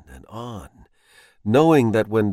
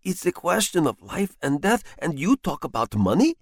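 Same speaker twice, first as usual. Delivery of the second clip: lightly raised voice of a man who thinks he's dying; frightened husband; 7 semitones higher than usual. Acted. Husband confesses to his wife he's drunk paraffin, mildly alarmed. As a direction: tell her of your doom in alarm.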